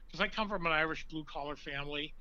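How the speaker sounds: ordinary talk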